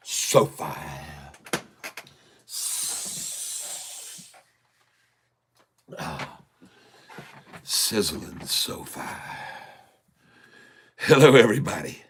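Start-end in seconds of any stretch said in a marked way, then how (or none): 0.1-9.0 golf voice. Pretend. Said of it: "my golf voice".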